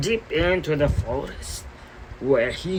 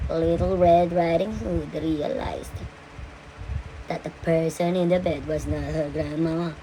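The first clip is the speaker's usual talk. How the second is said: plain reading voice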